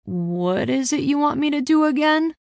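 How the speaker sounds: uncertain. A narrator's reading. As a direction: ask it unsure of what is wanted. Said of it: "Clayton asked uncertainly".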